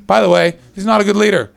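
ordinary talk, nothing marked

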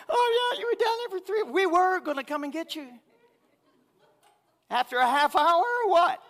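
very high, loud sarcastic mimicry; sarcastically imitating his friends, high and loud; mocking friends. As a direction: parody their lazy rescue plan. Mocking the friends' casual excuse.

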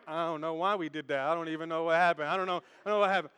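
low, softened mumble of not knowing; clueless excuse-making. Acted. Answering Pastor Brian's call, low and clueless. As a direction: plead ignorance in a low, hurried voice. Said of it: "If I'm talking to Pastor Brian".

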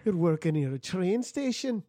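put-on slow Scottish accent; a Scottish impression, slow; Mike Myers Scottish. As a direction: drawl it like a Mike Myers Scot. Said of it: "You're doing Mike Myers".

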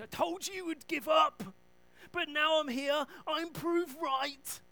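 a loud mocking told-you-so; mock scolding shout. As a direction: belt it out like a smug scold. Jesus hypothetically scolding Peter.

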